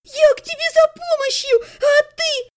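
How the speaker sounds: very high, tearful reproach; hurt pleading. A narrator's reading. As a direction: wail at your brother's betrayal. Sister pleads with brother. (обиженно, тонким плаксивым голосом)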